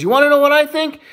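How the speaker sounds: put-on high, loud, insistent kid; little boy. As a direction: pester Mom, pitched right up. Little boy pesters his mom.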